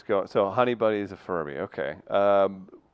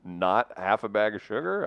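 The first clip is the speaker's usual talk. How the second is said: usual speaking voice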